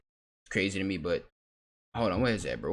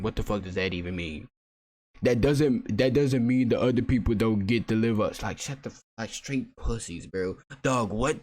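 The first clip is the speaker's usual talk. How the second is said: ordinary talk, nothing marked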